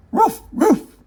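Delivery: high, loud woof-woofs; dog answering his overjoyed boy; dog barking. Acted. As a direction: bark happily like a dog. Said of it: "replied Scamp".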